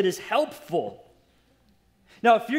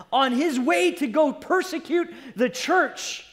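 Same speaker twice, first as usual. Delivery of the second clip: higher, driving pulpit storytelling; impassioned preaching; 5 semitones higher, 3 dB louder than usual. Real, not acted. Preacher telling how Saul persecuted the church, impassioned. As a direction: pile up the charges with fervor.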